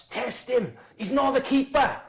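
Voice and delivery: high yelled heckle from behind the goal; retold heckler shout. Fan behind the goal heckling the sub keeper, high mock yell. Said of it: "turns around and shouts".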